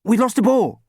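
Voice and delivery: high, loud, hurried blurt; gabbled. A narrator's reading. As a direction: gabble it out urgently. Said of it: "Sam gabbled".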